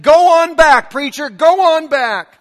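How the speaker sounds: hollering from the back pew. Retold or imitated. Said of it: "raised her voice a little bit louder".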